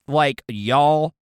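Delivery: drawn-out Southern y'all; Southern drawl on y'all. Shown as a demonstration. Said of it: "stuff here in the South".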